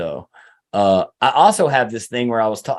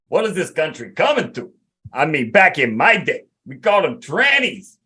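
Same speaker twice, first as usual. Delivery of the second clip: outraged Italian guy, pitched higher, ranting at what he sees; Italian guy impression; 5 semitones higher than usual. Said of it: "impersonation of an Italian guy".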